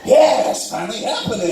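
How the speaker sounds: high-pitched comic celebration; triumphant glee. Retold or imitated. He reacts to her taking her shirt off, high triumphant glee.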